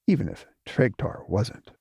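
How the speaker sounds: usual speaking voice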